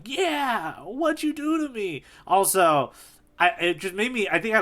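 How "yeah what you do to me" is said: high, whiny mock plea; poor Richie voice. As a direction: squeak it out like a hurt little guy. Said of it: "poor Richie being like".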